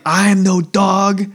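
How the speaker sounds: slow, emphatic acted protest; mock offence. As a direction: imitate an offended denial, word by word. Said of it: "so upset and offended".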